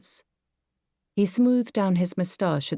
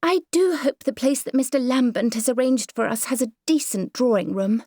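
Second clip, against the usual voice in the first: higher, genteel lady fretting about the drawing room; a fussy society lady, higher and louder; fussy Myrtle voice; 8 semitones higher, 3 dB louder than usual. Acted. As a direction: play the prim, self-satisfied mother. Myrtle speaking in the story.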